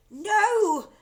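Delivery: very high, loud no; crying out. A narrator's reading. Daughter crying out to stop her mother. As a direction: cry it out in alarm. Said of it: "cried Missus Cranky".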